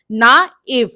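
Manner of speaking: नाइव split as "naa-iv", full vowel sounded. Shown as a demonstration. Teacher demonstrates naive with its full vowel sound, नाइव carefully sounded out. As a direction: say नाइव loudly, both vowels distinct. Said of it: "आई का sound पूरा आ रहा है".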